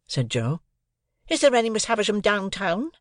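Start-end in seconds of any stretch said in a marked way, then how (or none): 1.3-2.9 scornful retort. A narrator's reading. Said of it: "returned my sister".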